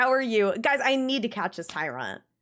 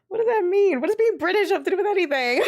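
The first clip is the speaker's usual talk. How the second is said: high, loud incredulous laugh-talk; cracking up in disbelief; reading a ridiculous chat message, high and loud through laughter; 11 semitones higher, 5 dB louder than usual. Real, not acted. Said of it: "funniest messages I've ever read".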